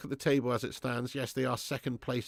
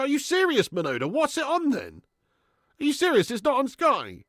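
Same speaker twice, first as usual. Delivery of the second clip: outraged disbelief; 8 dB louder than usual. Real, not acted. Game not on Sky TV.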